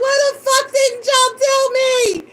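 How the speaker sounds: high, loud indignant wail; acted tearful, angry complaint; mad at mom. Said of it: "I was mad at my mom".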